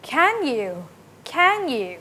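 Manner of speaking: eager short question, pitch swooping up and down; very interested. Shown as a demonstration. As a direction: show keen interest with a big rise-fall on "Can". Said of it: "if you're very interested".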